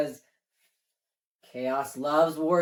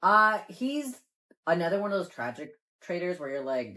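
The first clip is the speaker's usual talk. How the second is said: normal talking voice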